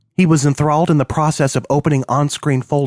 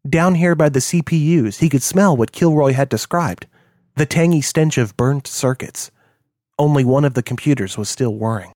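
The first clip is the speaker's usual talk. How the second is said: ordinary delivery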